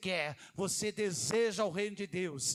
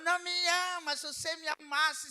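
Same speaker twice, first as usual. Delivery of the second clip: a higher, put-on voice of a pestering wife; nagging Delilah; 5 semitones higher, 4 dB louder than usual. Pastor voicing Delilah pressing Samson, higher nagging. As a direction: wheedle and accuse at once.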